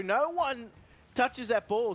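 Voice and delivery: real excited commentator call. Real, not acted. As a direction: lift the voice as the ball slips past everyone. Live play-by-play of a chance.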